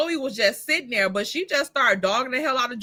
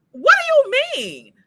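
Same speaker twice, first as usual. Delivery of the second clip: mock confused; 13 semitones higher, 5 dB louder than usual. Mimics Vanessa's confusion.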